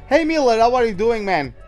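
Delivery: loud, high hello to a viewer; high-pitched, loud shout-out; hearty greeting. Real, not acted. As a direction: greet a new arrival with a burst of energy. Streamer calls out greeting to viewer.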